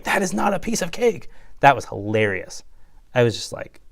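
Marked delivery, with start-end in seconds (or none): 0.0-1.3 quoting Billy. Retold or imitated. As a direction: toss it up high like a nervous kid. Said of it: "and he's like".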